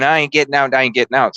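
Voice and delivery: normal talking voice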